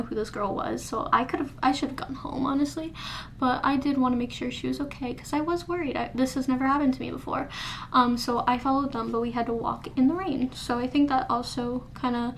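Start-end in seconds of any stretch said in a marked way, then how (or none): none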